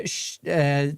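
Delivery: normal talking voice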